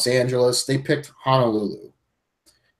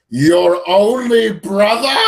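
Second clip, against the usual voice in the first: slow, much higher, louder hammed-up cry; theatrical villain line; 7 semitones higher, 5 dB louder, slower than usual. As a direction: overplay it like a melodramatic villain. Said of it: "He says it like that with that kind of atmosphere".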